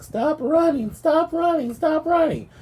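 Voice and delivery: high, urgent calls of stop; ladies yelling. Retold or imitated. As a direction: squeal it high like bystanders shouting. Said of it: "I hear some ladies like".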